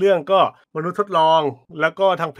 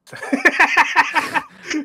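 a sudden, high-pitched burst of laughter; 11 semitones higher than usual. Real, not acted. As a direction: laugh out before telling the story. (หัวเราะเสียงสูงออกมา)

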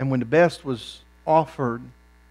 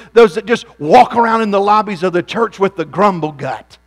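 rising, louder preacher's cadence; preaching at full force; 6 semitones higher, 9 dB louder than usual. Acted. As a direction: build the repetition like a revival sermon.

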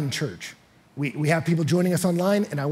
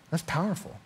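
ordinary delivery

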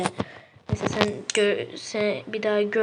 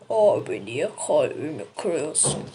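hurt voice; 6 semitones lower than usual. Acted. Character hurt by rejection.

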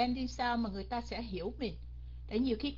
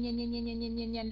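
"nhanh" rattled off in one run; rapid repetition. Shown as a demonstration. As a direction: repeat "nhanh" quickly without a break. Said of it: "rồi mình nói nhanh".

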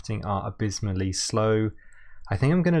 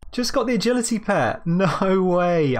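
excited; 8 semitones higher, 4 dB louder than usual. Real, not acted. Rare pet drop surprise.

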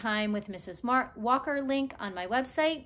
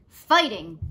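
barked; 10 dB louder than usual. Acted. Pushkin the dog answers fast, mock bark. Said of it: "barked Pushkin right away".

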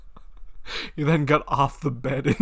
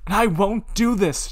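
a loud, higher shout of refusal; a shouted refusal, pitch up; 8 semitones higher, 6 dB louder than usual. Acted. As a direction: yell it back at him. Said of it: "John shouted".

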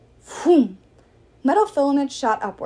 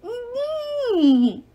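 high, muffled complaining whine; sock-gagged goddess whining; gagged whimper; 13 semitones higher than usual. Acted. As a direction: protest through a gag. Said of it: "Nike complained".

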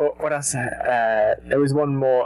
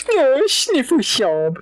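mock sobbing; 9 semitones higher, much slower than usual. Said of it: "I liked your".

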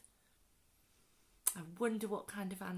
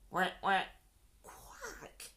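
imitated quacking; slower than usual. A narrator's reading. Narrator voicing an animal for children, imitated quacking. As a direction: make little duck quacks. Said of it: "began to".

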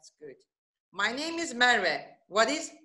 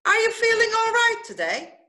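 bright teaching question; 12 semitones higher than usual. Shown as a demonstration.

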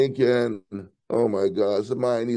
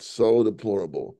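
ordinary delivery

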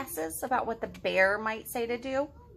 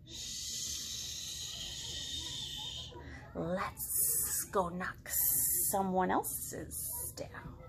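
soft, slow hissy snake; hushed sly snake voice; sneaky snake; 9 dB quieter, much slower than usual. Acted. Snake character voice.

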